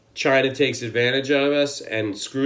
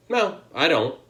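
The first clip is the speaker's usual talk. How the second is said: normal talking voice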